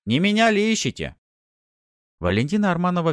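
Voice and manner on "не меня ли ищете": high, loud taunting call. A narrator's reading. Hero offering himself to the bandits, shouted. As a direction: call it across to them. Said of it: "крикнул бандитам".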